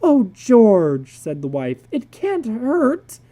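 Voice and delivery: coaxing wife. Acted.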